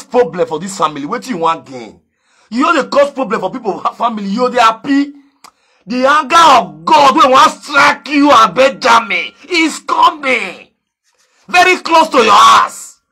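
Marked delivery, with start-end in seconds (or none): 5.9-13.0 loud, slow, higher proclamation of doom; preacher's doom warning; mock curse. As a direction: thunder it like a preacher pronouncing judgement. Pronouncing divine punishment.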